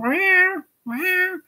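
high meowing cat. Retold or imitated. The cat sitting there, a high mock meow. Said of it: "She went".

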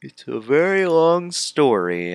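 loud, high theatrical story opening; hammed-up storyteller. Character starting a long sad story, hammed-up delivery. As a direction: ham up the start of a tale.

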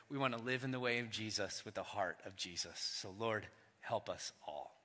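low, soft and reverent; soft reverent plea; hushed prayer. Acted. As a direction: pray it quietly. Softening into prayer.

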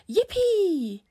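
high, loud whoop of delight; loud jubilant cry; gleeful cheer. A narrator's reading. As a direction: cheer a goal with a long rising-falling squeal. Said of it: "they cried, and".

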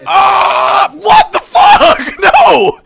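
real high, loud shriek of shock; startled scream. Real, not acted. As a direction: yell out in panic, breaking into laughter. Startled by a scare in the game.

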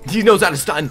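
loud, high protest; alarmed outcry. Real, not acted. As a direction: cry out as the stun hits. Gets stunned by doubted opponent.

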